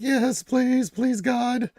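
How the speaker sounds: begging for coffee, high; desperate plea. Retold or imitated. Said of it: "I'm like".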